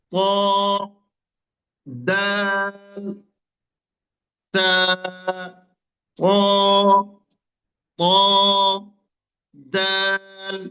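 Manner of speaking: "ক", "দাল", "তা" each held long, tongue on the upper-teeth root; articulation drill. Shown as a demonstration. Teacher showing students three Arabic letters, long drawn-out drill. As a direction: isolate and stretch "ক", "দাল", "তা" on a level note, pausing between. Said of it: "সেখান থেকে উচ্চারণ করবেন তিনটা অক্ষর".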